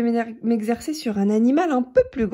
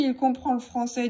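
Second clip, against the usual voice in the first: plain reading voice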